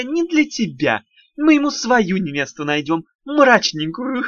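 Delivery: high, giggling little imp voice; sly teasing with a snicker; giggly imp. Acted. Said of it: "Комиссионер захихикал". (хихикающий вкрадчивый голосок беса)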